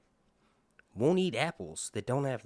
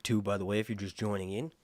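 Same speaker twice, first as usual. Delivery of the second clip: usual speaking voice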